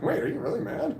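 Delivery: high-pitched, amused puzzlement; retold confusion. Employee asking his yelling manager, retold as confused. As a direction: imitate a baffled question through amusement. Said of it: "I was confused".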